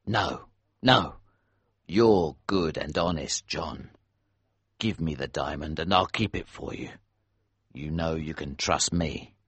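low, soft reassurance; gentle reassuring. A narrator's reading. Elsevier speaks gently.

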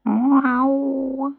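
kitten meow. A narrator's reading. Kitten answers.

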